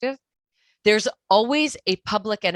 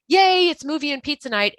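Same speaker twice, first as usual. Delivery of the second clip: a much higher, louder cheer on "Yay, it's movie and pizza night"; cheerful yay; 9 semitones higher, 4 dB louder than usual. Pretend. Parent welcoming kids home for pizza night, excited cheer. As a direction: greet the kids with bright pretend delight. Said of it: "Just be like".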